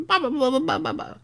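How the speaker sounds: high, fast nervous babble; Charlie Day babble. Retold or imitated. Streamer showing chat his neurotic rant, high sputter. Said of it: "he's like".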